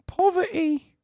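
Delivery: squeaky-high mock British falsetto; high-pitched British voice. Shown as a demonstration. Streamer obliging a viewer's request, high-pitched British voice. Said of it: "Please do the high pitch".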